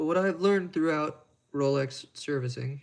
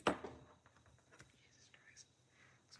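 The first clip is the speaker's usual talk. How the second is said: whisper; 18 dB quieter than usual. Real, not acted. Muttering aside mid-stream.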